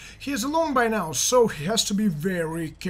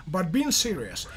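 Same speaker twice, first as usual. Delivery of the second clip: usual speaking voice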